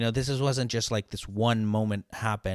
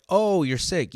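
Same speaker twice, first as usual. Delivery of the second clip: mocking boss; 8 semitones higher, 6 dB louder, slower than usual. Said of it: "they look at you like".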